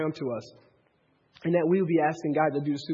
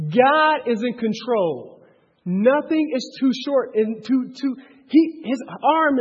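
preaching at full force; 6 semitones higher, 7 dB louder than usual. Acted. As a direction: hammer the point home to the room.

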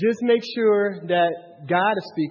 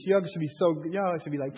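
ordinary talk, nothing marked

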